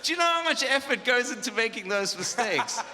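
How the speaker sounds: loud, high, laughing mock enthusiasm; mock rapture. Real, not acted. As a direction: gush over the failures as if they're treasures. Mock praise of worship fails.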